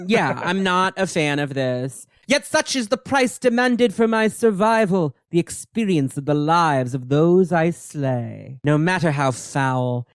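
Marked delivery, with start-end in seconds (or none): 2.2-10.1 slow, theatrical villainess lament; Selene. Acted. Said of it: "And she goes".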